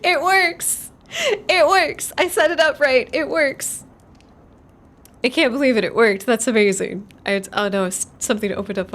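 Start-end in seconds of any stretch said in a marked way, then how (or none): 0.0-7.1 higher, louder delighted cries; real joyful surprise; thrilled. Real, not acted. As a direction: celebrate like it finally worked. A setup finally works.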